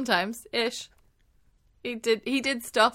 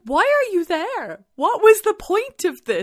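drawn-out, high, incredulous asking; slow, pitched-up disbelief at pointless cast; baffled mock question; 10 semitones higher, 7 dB louder, slower than usual. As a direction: play it like you can't believe they're in the scene. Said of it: "you're just like".

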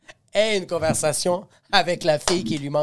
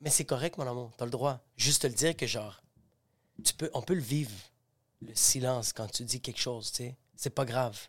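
doux et rassurant; 6 semitones lower, 12 dB quieter than usual. Retold or imitated. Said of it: "je fais".

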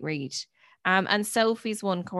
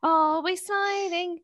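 high, slow, saccharine chirp; cheesy presenter; 8 semitones higher than usual. Pretend. Joking that the comedy podcast is always smiling, high and sugary. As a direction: sell it like a fake-happy host.

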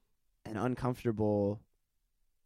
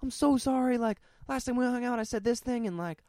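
much higher, put-on gushing apology; mock a friend over-apologizing; hammed-up apologetic friend; 13 semitones higher, 4 dB louder than usual. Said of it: "they're like".

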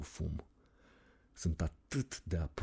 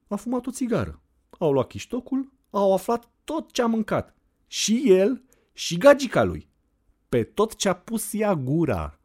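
put-on lively girl; 13 semitones higher, 7 dB louder than usual. Anda telling the narrator about the sniper documentary, high and animated. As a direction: tell it like gossip. Anda's voice held.

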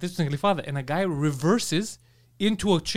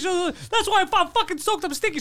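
retold angry yelling; 12 semitones higher than usual. Soaked passenger berating the lady, high mock-angry complaint. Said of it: "now he's yelling at this lady".

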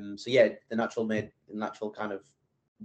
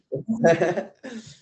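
laugh; 8 semitones higher, 4 dB louder than usual. Real, not acted. Laughing before awkward answer.

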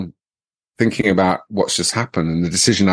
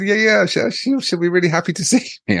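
very high, laughter-squeezed reply; mock deluded assurance; 11 semitones higher than usual. Husband sure his wife will be pleased, laughing. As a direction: insist brightly while fighting back laughter.

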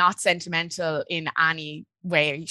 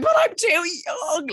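mock panic; 11 semitones higher, slower than usual. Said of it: "And I was like".

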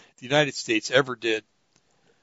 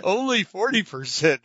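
laughing echo; 7 semitones higher than usual. Real, not acted. Laughs while echoing the caller.

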